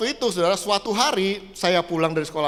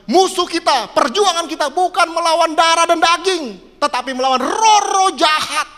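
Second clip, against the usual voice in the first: loud, high, forceful proclamation; commanding voice; 9 semitones higher, 7 dB louder than usual. Retold or imitated. Preacher voices the sudden voice quoting scripture, loud and commanding. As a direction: thunder it out as a sudden warning. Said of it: "tiba-tiba ada suara".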